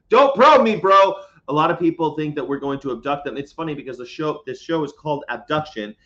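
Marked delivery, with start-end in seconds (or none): 0.1-1.2 "Don't probe me" yelled much higher and louder; shouted. Pretend. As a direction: shout it out as a mock protest.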